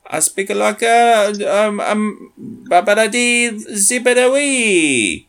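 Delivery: high, slow mock Italian gibberish; fake Italian. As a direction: babble like pretend Italian. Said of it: "it's like people pretending to speak Italian".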